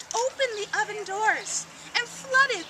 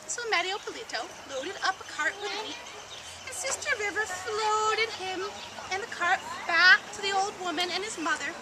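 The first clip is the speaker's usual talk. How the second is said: ordinary delivery, nothing marked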